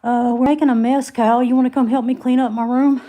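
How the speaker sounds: loud call to another room. Real, not acted. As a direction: raise the voice to call someone. Calling out to Kyle.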